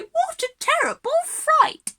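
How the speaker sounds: high, loud mock-scared neighbours; neighbours' fright. Teacher voices the startled neighbours in the song, high mock alarm. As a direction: squeal it like a scandalised neighbour.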